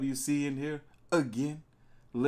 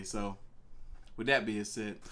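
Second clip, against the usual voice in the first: usual speaking voice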